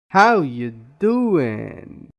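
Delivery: "how" loud, "doing" punched and drawn out; slow, lean hard on "how", then on "doing"; stress on HOW and DOING. Shown as a demonstration. Teacher showing another way of stressing, loud "how" and stretched "doing". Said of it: "Different ways of stressing on words".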